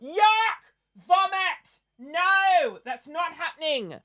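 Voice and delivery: high, disgusted yuck. Retold or imitated. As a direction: recoil at the idea, pitch up high. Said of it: "I'm like".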